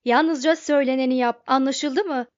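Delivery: loud, insistent order; shouting. A narrator's reading. Zafot ordering the sulking robot to obey, shouting. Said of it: "diye bağırdı".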